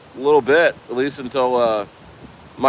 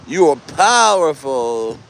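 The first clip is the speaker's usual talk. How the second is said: attempted Italian accent; slower than usual. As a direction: try a hammy Italian accent, stretching POWERFUL. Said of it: "I can't do an Italian accent".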